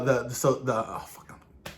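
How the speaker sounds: normal talking voice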